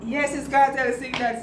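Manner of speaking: usual speaking voice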